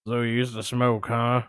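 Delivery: lurk low like a shady caller; a hint of Buffalo Bill, low; creepy caller. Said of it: "He's kinda like Buffalo Bill".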